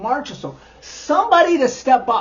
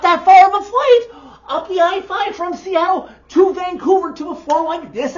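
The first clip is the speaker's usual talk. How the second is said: ranting louder and higher; frustrated shout; 8 semitones higher, 6 dB louder than usual. Real, not acted. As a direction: yell it out at the team. Ranting at team.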